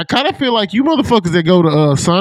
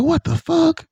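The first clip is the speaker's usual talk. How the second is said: high, incredulous "what the fuck"; disbelief; 13 semitones higher, slower than usual. Retold or imitated. Told of a friend's odd order, reacting in disbelief. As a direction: sound floored by the order.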